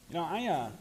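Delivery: normal talking voice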